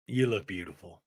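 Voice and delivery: low, soft romantic murmur; tender compliment. Retold or imitated. Jared compliments Brittany at dinner, low and tender. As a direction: imitate a smitten man, voice dropped low.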